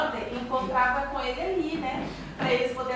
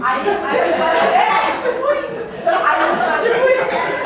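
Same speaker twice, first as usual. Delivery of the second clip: high squealing laughter, much higher and louder; laughing hard; 9 semitones higher, 12 dB louder, slower than usual. Real, not acted. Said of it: "Um dinheiro no bolso?". (rindo alto e agudo)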